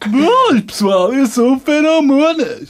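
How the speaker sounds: high, slow youtuber greeting; Feromonas impression. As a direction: squeak out a slow cartoonish hello. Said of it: "como é que é a voz dele".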